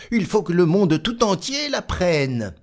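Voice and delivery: lightly voiced proud little tailor; boastful little tailor. Tailor marvelling at his own valour, lightly boastful. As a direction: brag to yourself, pleased.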